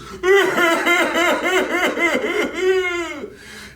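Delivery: a burst of high, loud laughter; real giggle. Real, not acted. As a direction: let out a big squealing laugh. Laughs while shooting out tears.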